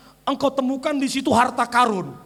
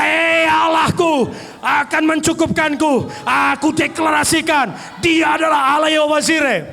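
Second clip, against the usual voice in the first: bold proclamation; 4 dB louder than usual. Shown as a demonstration. Preacher shows the strong declaration, loud shout. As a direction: boom it with faith. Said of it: "Kau harus berkata".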